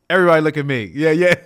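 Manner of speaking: hyped-up player playing to the stadium; showing off. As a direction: shout it out, hyped and boastful. Acts out showing off to crowd.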